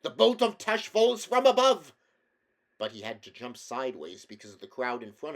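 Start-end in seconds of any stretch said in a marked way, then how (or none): 0.0-1.9 high, loud battle cry; a proud prince's grand, loud battle cry. A narrator's reading. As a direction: leap down roaring a war cry.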